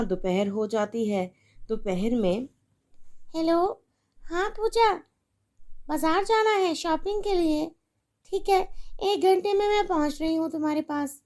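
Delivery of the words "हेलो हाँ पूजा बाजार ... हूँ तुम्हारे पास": higher, breezy, cheerful phone chat; making shopping plans with a friend; chatty mother on the phone. Acted.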